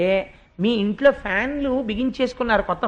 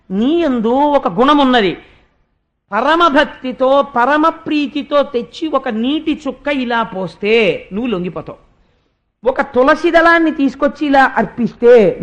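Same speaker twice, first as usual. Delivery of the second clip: fervent preaching; 3 semitones higher, 6 dB louder than usual. Acted. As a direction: drive each word home to the devotees. Preaching at full force.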